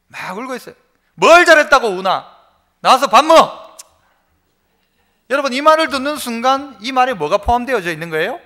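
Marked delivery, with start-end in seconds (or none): 1.1-3.6 put-on louder, a scolding mother calling her crying child out; mother's loud scolding call. Mother scolds her crying child to come eat, loud. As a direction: snap at the child, then call them to eat. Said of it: "어머니가 부릅니다".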